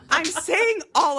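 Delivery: said through a laugh; chuckling it out; amused, half laughing. Real, not acted. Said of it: "repeats it plainly".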